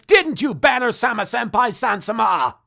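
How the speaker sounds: exaggerated accusing shout; mock outrage. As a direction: scold him in a shrill mock tantrum. Host rants at Banner.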